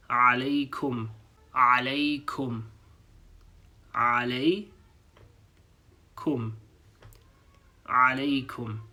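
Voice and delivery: "alaikum" opening with a throaty ain; Arabic ain sound. Shown as a demonstration. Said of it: "beginning letter of this word sounds like this".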